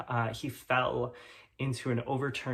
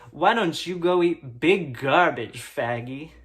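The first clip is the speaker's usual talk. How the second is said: mock bully sneer; 5 dB louder, slower than usual. Bully taunting narrator.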